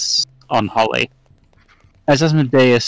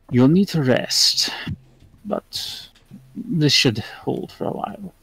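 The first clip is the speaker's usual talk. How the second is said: put-on soft, measured old man with pauses; kindly old man; 6 dB quieter than usual. Old man reassuring the one he just healed, softly. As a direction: let each phrase trail off softly.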